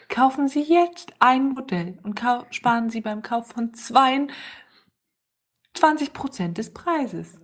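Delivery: high, slow TV salesman pitch; chipper 1950s ad. Acted. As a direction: sell it like an eager announcer. Mock drone sales pitch.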